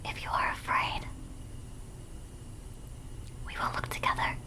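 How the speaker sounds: eerie, hushed horror whisper. Acted. Streamer reading scary video text, whispering. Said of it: "The whispering voice goes crazy".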